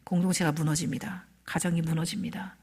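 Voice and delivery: unvoiced grave whisper. Real, not acted. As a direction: whisper it as a warning. 설교 속삭임. (경고하듯 속삭이며)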